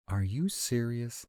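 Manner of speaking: high-pitched disbelieving question; incredulous. Acted. Incredulous at a friend's plan.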